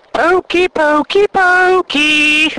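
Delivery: high, loud sing-out pokes; annoying pest. Retold or imitated. Office pest poking a tired colleague with a stick, annoying. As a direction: needle someone like a gleeful pest. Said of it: "Mister Tall came up with his proverbial stick and went".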